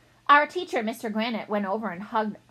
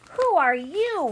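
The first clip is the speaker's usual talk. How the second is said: put-on startled kid question; 7 semitones higher, 5 dB louder, slower than usual. Kids question an alien.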